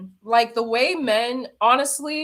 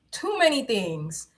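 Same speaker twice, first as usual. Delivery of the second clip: ordinary talk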